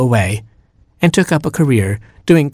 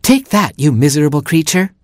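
high, punchy victorious jeer; triumphant taunt; 5 semitones higher than usual. Acted. Mockingbird scolds the stricken cat, high triumphant taunt. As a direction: start high and crow over the enemy.

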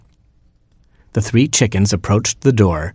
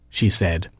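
ordinary delivery

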